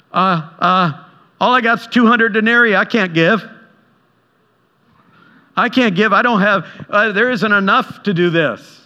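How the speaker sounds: high, whiny, fretful voice of Philip; panicky Philip. Retold or imitated. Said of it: "Philip goes".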